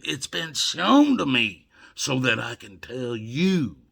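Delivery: ordinary talk, nothing marked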